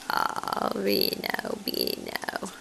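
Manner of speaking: hushed coo sinking to a whisper; soft cooing. Real, not acted. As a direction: coo very softly to the cat.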